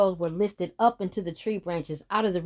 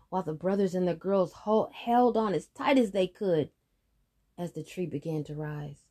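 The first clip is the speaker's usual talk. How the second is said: ordinary delivery, nothing marked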